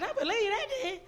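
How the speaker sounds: high, loud burst of belief; elated, emphatic yes to God; excited acceptance. Retold or imitated. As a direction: exclaim it, pitch leaping up. Repeats reply, far more excited.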